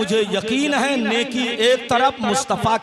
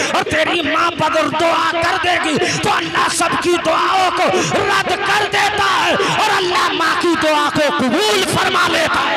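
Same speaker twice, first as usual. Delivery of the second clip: loud, high-pitched sermon cry; full-force preaching; 6 semitones higher, 6 dB louder than usual. Acted. Preacher telling the crowd about a mother's prayer, full-force preaching. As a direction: preach it at a shout.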